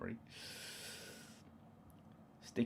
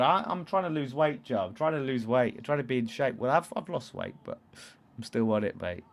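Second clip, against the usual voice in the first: ordinary delivery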